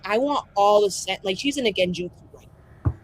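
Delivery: ordinary talk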